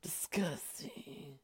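slow whispered mock disgust; mimicking raspy caller. Continues the caller imitation.

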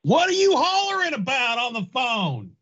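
high, put-on complaining voice; hammed-up high-pitched scolding; annoyed household character. Acted household quarrel during prank call.

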